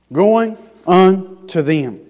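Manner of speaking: "Going unto them" said very slowly; slow emphasis. Acted. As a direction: draw out each word. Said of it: "He said He was going unto them".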